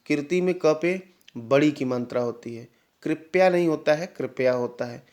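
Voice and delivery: usual speaking voice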